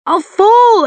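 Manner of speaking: very high, loud cry of panic; terrified shriek. A narrator's reading. Climber clinging to a cliff over the sea, shrieking. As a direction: shriek it at the top of your voice. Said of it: "I shriek".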